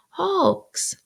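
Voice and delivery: high, falling echo; wondering child. A narrator's reading. Pip repeats the answer to Joe, wondering. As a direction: repeat it like a puzzled boy.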